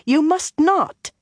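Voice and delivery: slow, urgent plea to stop; alarmed gasp. A narrator's reading. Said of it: "Min gasped".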